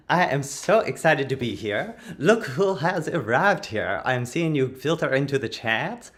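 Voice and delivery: ordinary delivery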